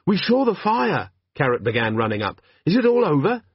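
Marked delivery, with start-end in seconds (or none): 0.1-1.1 put-on a higher, slower earnest young voice; young constable. Constable runs up to the fire scene, earnest young voice. Carrot running up. 2.6-3.4 put-on young constable. Constable runs up to the fire scene, earnest young voice. Carrot running up.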